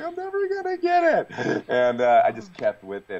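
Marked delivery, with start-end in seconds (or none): 0.0-1.6 high-pitched exasperated wail, retold; mock despair. Actor retelling his London accent practice on the treadmill, mock despair. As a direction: recreate a frustrated outburst, voice shooting way up.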